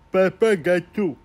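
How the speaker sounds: high, slow, drawn-out daddy coo; hammed-up indulgent dad, slowed right down. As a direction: stretch out Papa gâteau like a cooing father. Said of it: "je le voyais comme ça".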